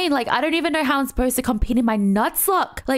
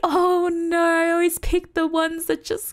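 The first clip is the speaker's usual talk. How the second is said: mock lament; 6 semitones higher than usual. Laments always picking nerfed mains.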